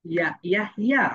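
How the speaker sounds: ইয়া repeated rising; letter sound ya. Shown as a demonstration. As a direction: drill "ইয়া". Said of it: "ওয়াই sounds like ইয়া".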